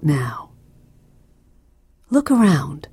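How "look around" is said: ordinary delivery, nothing marked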